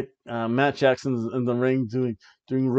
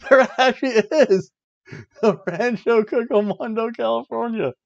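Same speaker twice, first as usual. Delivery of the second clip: high, loud disbelief at the place name; amazed; 10 semitones higher, 4 dB louder than usual. Real, not acted. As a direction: sound genuinely floored by it. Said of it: "I was kinda half shocked".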